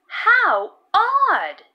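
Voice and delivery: bright wondering exclamation; surprised "how odd". Acted. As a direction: marvel at the odd sight. Todd remarks on odd sight.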